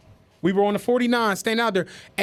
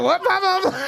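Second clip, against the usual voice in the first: confused cashier; 7 semitones higher, slower than usual. Retold or imitated.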